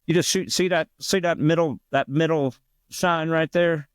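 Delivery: high, know-it-all bar guy voice; imitating the bar player. Imitating the bar guy giving bad advice, high and sure of himself. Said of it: "he talked like that, of course".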